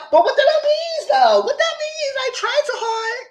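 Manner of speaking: put-on very high, cracking whine of a lost girl; mocking the contestant's high distressed confusion; whiny confused girl. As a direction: squeak it like she's about to cry. Imitating her confused contestant.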